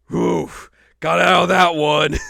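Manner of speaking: high, loud relieved laugh; laughing relief. Retold or imitated. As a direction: laugh off the close call. Mister Satan relieved, laughing.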